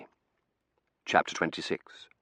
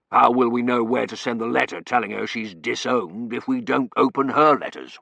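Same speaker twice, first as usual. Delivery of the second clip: lightly voiced colonel; 4 semitones higher, 6 dB louder than usual. Colonel answers wife.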